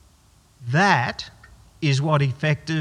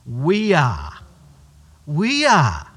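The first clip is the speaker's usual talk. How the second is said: second "We are" louder; emphatic declaration; 6 dB louder, much slower than usual. Acted. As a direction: proclaim it, then hit it harder. Emphatic answer.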